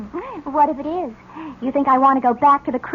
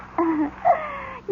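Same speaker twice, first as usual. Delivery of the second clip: high giggle; 10 semitones higher, 5 dB quieter than usual. Acted. Laughing after taunting David.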